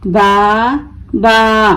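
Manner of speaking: बा, pause, बा, each held; broken into syllables. Shown as a demonstration. Teacher breaking the word for learners, each बा held apart. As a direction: say बा twice as separate long pieces. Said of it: "तोड़ तोड़ कर पढ़ूंगी".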